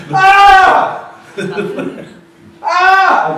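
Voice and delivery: loud, high open "Ah!"; long loud vowel demo; open vowel shout. Shown as a demonstration. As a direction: belt out a bright open vowel. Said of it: "I'm naming something".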